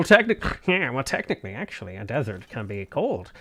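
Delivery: put-on voice of a nitpicker; sneering semantics-stickler impression; mocking pedant. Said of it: "Little Miss Semantics".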